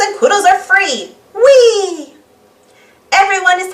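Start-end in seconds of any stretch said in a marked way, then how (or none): 1.3-2.1 high excited cheer; whee. Acted. Freed friends cheering, high and gleeful. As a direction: squeal it high with joy.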